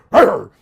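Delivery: loud playful growl; mock deep growl. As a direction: growl it like a boy whose voice just dropped. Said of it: "with that deep voice go".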